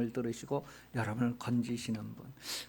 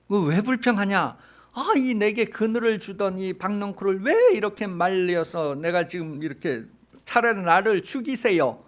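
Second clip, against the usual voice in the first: loud, higher whining outcry; Jonah's bitter complaint; 4 semitones higher, 5 dB louder than usual. Retold or imitated. Jonah crying out to God that he'd rather die, loud complaint. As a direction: shout out the grievance, fed up. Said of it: "막 그렇게 막 불평을 토로하는 거야".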